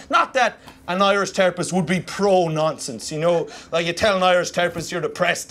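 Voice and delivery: usual speaking voice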